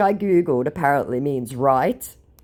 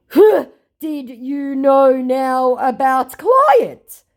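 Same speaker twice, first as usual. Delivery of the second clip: very slow, high and louder, put-on accent; mock scammer voice; 10 semitones higher, 7 dB louder, much slower than usual. Quoting the worried scammer, slow and put-on. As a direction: draw it out, suspicious and dim.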